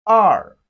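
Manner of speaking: "R" loud, dropping steeply in pitch; falling tone. Shown as a demonstration. Teacher models Chinese two for learners, loud falling "R". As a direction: hit "R" hard and let it fall. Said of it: "It has the falling tone".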